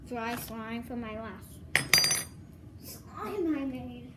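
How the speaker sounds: talking with a cold; a head cold, blocked nose; stuffy nose. Real, not acted. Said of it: "I have a cold".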